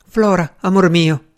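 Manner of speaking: a fretful aunt calling out, mildly plaintive; slightly querulous. A narrator's reading. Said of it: "chiamò con voce leggermente querula". (voce leggermente querula)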